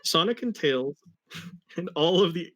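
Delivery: ordinary delivery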